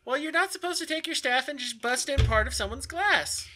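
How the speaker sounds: high, reproachful guard voice; scolding guard. Acted. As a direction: tell the culprit off, pitched high. Game master voices the guard.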